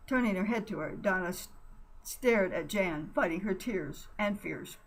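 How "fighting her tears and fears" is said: ordinary delivery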